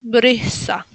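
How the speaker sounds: "Brisa" as brysa, vowel between E and I; duck-lipped Y. Shown as a demonstration. Teacher tells learners to use duck mouth, Polish Y in "Brisa". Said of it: "como una boca de pato".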